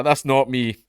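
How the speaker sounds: ordinary delivery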